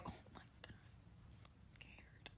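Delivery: barely audible whisper, slowing down; scared inner whisper. Retold or imitated. Hiding fear from the date in the back of her head, scared whisper. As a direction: whisper a hidden panic to yourself. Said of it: "In the back of my head".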